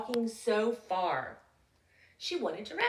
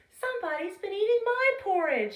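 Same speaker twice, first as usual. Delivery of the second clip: higher, sweet bear mother; sweet Mama Bear; 10 semitones higher, 5 dB louder than usual. Acted. Mama Bear noticing her porridge, sweet and high. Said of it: "she explained in her sweet voice".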